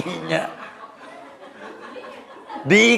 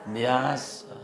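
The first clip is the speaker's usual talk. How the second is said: ordinary talk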